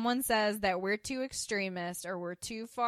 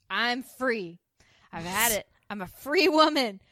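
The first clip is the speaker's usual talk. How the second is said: higher, louder, acted burst of freedom; defiant rant; 5 semitones higher, 6 dB louder than usual. Carlene announcing she's a free woman, defiant acted rant. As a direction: declare it boldly, each boast swooping up.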